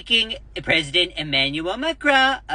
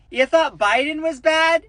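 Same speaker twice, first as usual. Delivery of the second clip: ordinary talk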